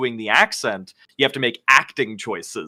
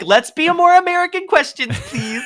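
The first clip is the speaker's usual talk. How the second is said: mocking directors; 4 dB louder than usual. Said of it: "They're like".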